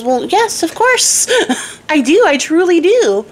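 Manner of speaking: high, giggling earnest reply; sweet princess. Acted. Daughter answers sweetly, laughing.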